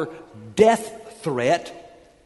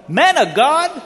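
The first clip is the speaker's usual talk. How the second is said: loud, high, slow demanding shout; insolent command; 10 semitones higher, 7 dB louder, slower than usual. Acted. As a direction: spit out the order with contempt. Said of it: "He's even more insolent".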